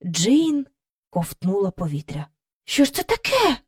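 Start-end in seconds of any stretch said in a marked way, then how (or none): 2.6-3.6 higher, startled question; startled by the strange kite; exclaim in surprise. Acted. Jane sees the kite come down, startled exclaim. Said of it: "вигукнула вона".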